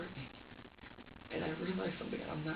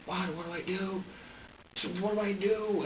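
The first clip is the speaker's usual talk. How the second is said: retold desperate plea, pitched up; helpless plea; 6 semitones higher than usual. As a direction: relive being at a loss, voice climbing. Retelling helplessness at the screaming baby.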